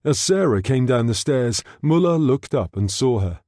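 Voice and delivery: plain reading voice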